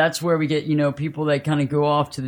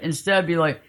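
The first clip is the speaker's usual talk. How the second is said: ordinary talk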